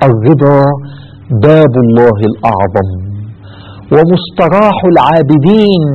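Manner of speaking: "باب الله الأعظم" said slowly, each word weighed; slow, weighty recitation. Shown as a demonstration. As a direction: dictate it slowly so they can write it down. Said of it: "اكتبوها". (إملاء بطيء ومتأنٍ)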